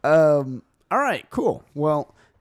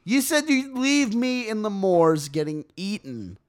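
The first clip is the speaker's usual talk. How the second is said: mock hurt; 9 semitones higher than usual. Accusing a friend of leaving him in the moors, mock betrayal.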